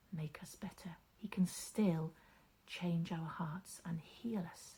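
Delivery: soft, whispered bedtime voice; hushed bedtime talk. A narrator's reading. Storyteller whispering a bedtime prayer to children, hushed. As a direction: lean in and speak gently to sleepy children.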